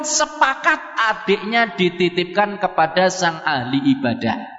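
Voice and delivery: usual speaking voice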